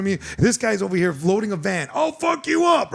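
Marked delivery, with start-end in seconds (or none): 1.9-2.9 high, shouted mock threat; mock drunk yell.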